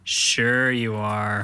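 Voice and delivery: slow, low sarcasm; sarcastic. Acted. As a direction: drag it out, not buying it. Sarcastic teasing.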